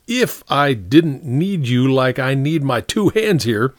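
high, exasperated officer; angry captain. Acted. Captain rebuking the sergeant who stands in his way, angry. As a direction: threaten and challenge your sergeant.

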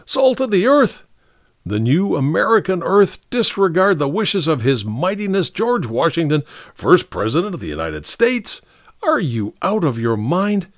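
mock-grand outburst at an absurd notion; crying out. A narrator's reading. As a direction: argue with rising, theatrical disbelief. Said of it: "cried Ellery".

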